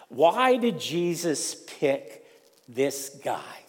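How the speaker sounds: usual speaking voice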